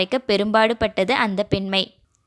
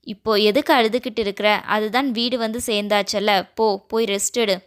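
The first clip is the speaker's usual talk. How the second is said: usual speaking voice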